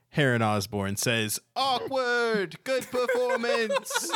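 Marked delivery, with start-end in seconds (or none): 1.6-4.1 very high, drawn-out character voice; high, stretched-out comic remark; awkward interjection. Acted. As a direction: pipe up far above your normal pitch. Heron comments on the awkward moment.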